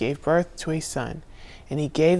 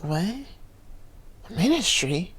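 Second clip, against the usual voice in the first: slow, drawn-out amazed question; stunned disbelief; 10 dB quieter, much slower than usual. Retold or imitated. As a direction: let it sink in, astonished. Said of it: "And I was like".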